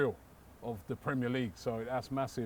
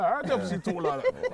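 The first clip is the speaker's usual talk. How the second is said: louder, slow put-on teasing voice; mock teasing question; 5 semitones higher, 5 dB louder, slower than usual. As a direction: tease him in a hammed-up voice. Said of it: "I'm only joking".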